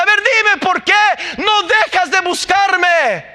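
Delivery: David's anguished plea rising to a high, loud cry; preacher voicing David pleading with Saúl, rising to a shout. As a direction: beg Saúl, building to a shout.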